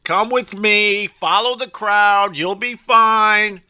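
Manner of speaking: high-pitched imitation of cops herding a crowd; mock screaming. Cops steering concertgoers off a wrong turn, mock screaming. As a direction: call it out high, slowing into long drawn words. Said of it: "they'll start screaming and blowing whistles".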